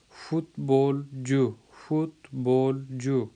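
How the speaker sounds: فٹ بال جو in slow separate pieces, fut... bol... ju; broken into syllables. Shown as a demonstration. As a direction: break up فٹ بال جو, pausing after each syllable. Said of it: "جس طرح سے آپ توڑ کے".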